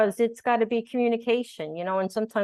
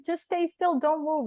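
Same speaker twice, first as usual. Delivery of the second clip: doctor ordering; 9 semitones higher, slower than usual. Retold or imitated. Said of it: "He's like".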